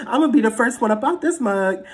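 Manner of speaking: loud, laughing through words. Real, not acted. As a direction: laugh while saying it. Laughing while talking.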